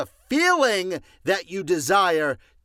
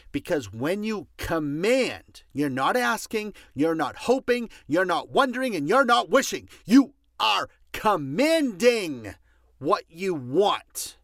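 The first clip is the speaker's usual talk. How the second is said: building louder and higher, preacher-style; passionate build; 4 semitones higher, 4 dB louder than usual. Real, not acted. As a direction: climb to a fiery peak. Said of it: "I know I'm getting a little loud".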